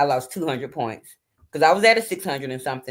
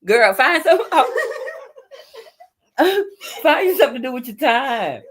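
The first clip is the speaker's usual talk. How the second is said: higher, louder, laughing through the words; laughing scold; 11 semitones higher, 5 dB louder than usual. Real, not acted. Mom laughing at her daughter's goofy late-night dancing, real laughter. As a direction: tell her off while cracking up.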